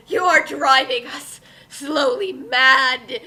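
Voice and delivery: normal talking voice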